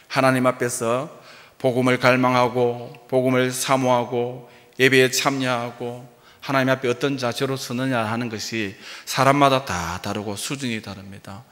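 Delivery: ordinary delivery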